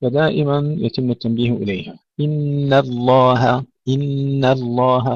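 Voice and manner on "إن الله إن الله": إن الله drawn out, light nun and ha; slow tajweed recitation. Shown as a demonstration. Teacher reciting for students. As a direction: chant إن الله slowly. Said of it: "ترقيق النون والهاء".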